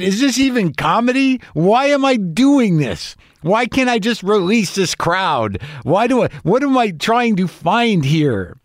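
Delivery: slow, pleading onstage breakdown, lightly played; questioning himself aloud, half-laughing despair; a mild retold meltdown; controlled meltdown. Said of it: "fairly controlled meltdown".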